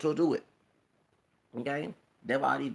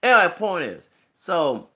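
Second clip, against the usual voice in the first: much louder, higher restart; loud reset; 4 semitones higher, 11 dB louder than usual. Real, not acted. Getting back to her point for viewers, loud. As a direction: bark it to get back on track.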